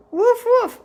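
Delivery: high, loud imitated woofs; two punchy mock barks; dog barking. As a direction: bark the words like a dog. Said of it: "The dog says".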